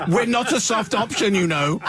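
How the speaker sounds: lightly put-on indignant objection; defensive geography teachers. Voicing indignant geography teachers in story.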